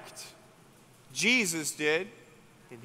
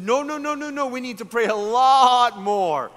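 mock alarm; 6 semitones higher, 8 dB louder than usual. Alarmed evangelist stops her walking.

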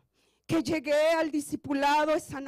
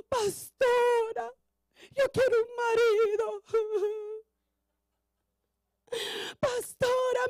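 mock crying women; 7 semitones higher, slower than usual. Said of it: "llegaban llorando".

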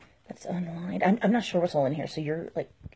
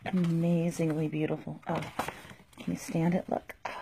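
ordinary talk

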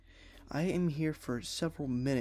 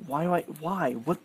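ordinary talk, nothing marked

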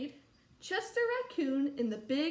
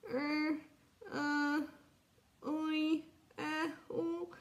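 higher, muffled hesitant sounds; quiet, muffled mumble; 4 semitones higher, much slower than usual. Acted. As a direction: mumble wordlessly, as if mouth is covered. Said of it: "in a quiet, muffled voice".

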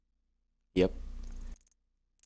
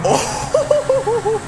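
excited shout; 6 dB louder than usual. Real, not acted. Stunt jump reaction.

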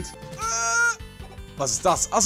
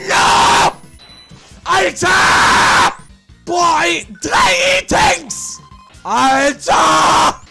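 loud frustrated shouts; excited yelling; 8 semitones higher, 8 dB louder, much slower than usual. Real, not acted. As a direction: shout at the screen. Game excitement. (lautes Schreien)

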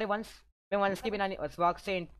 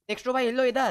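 loud, higher hail to a friend; calling out; 4 semitones higher, 5 dB louder than usual. Real, not acted. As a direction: call someone over from a distance. Calling player over. (ऊँची आवाज़ में पुकारते हुए)

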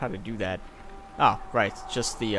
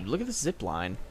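usual speaking voice

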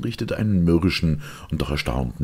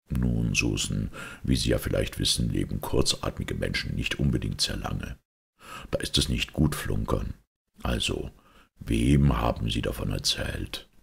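Holmes; 4 semitones lower than usual. Acted. Detective warns the lying maid, low and deliberate. Voices Holmes veiled threat to Susan.

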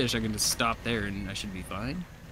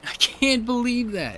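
disbelief; 12 semitones higher, 3 dB louder, slower than usual. Real, not acted. Still upset at loss.

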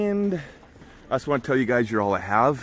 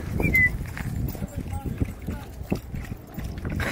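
long high giggle; amused laugh. Real, not acted. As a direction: giggle helplessly at the dog. Amused by dog in water.

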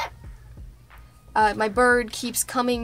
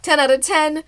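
louder, higher verdict; excited rating; 4 semitones higher, 7 dB louder than usual. Real, not acted. Rating embroidery, a loud excited verdict. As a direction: declare it with glee.

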